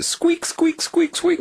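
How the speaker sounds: very high, repeated mouse squeaks. Retold or imitated. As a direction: imitate a squealing little mouse. Imitates the caught mouse squeaking.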